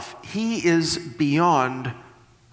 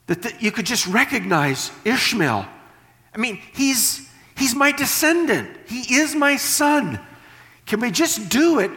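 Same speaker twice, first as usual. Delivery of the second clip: pleading Abraham; 7 semitones higher than usual. Retold or imitated. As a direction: beg God like a worried father. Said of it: "it's like".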